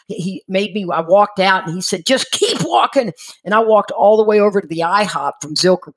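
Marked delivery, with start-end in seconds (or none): 2.1-3.1 pitched-up pretend holler; retold yelling. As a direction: imitate an urgent order over the phone. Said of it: "he started yelling at me".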